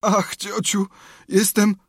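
high, sighing invalid voice; pitiful moaning. A narrator's reading. Tomek playing sick for aunt, moaning.

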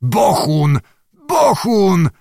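alarmed, insistent exclamation; terrified astonishment. A narrator's reading. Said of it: "malował się przestrach w połączeniu z największym zdumieniem".

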